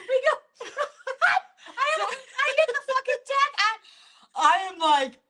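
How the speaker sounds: high-pitched laughing through words; laughing hard. Real, not acted. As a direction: crack up mid-sentence. Laughing hard telling story.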